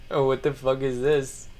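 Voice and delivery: usual speaking voice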